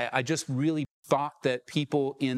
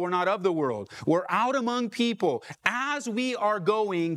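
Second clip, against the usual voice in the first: ordinary talk, nothing marked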